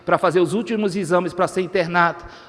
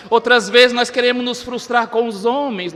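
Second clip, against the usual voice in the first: ordinary talk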